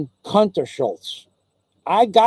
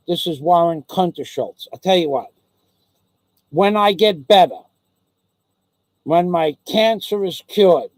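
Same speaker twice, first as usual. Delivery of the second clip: ordinary talk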